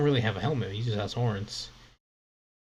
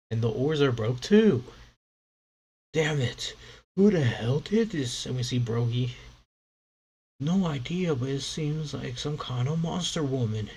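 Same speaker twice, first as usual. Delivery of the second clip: rough pirate crew; 4 semitones higher, 3 dB louder than usual. Acted.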